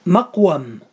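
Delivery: مقوم sounded out "maq-wam", a after the waw; corrected fatha on waw. Shown as a demonstration. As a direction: model مقوم with an open last vowel, "maqwam". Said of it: "بفتح العين". (تصحيح مقوم بفتح الواو: مَقْوَم)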